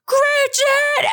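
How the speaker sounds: very high, loud shrieked name; wife mimicking husband's panicked shout; imitating his scream. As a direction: shriek it like he did. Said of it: "he literally does the scream again".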